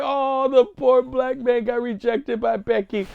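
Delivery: high, drawn-out fake sympathy; mock pity. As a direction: wail it slowly in exaggerated sorrow. Voicing a mock sympathetic headline.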